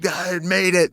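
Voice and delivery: high-pitched excited exclamation; mock triumph. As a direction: imitate giddy delight, pitch leaping up. Acting out excitement at friend.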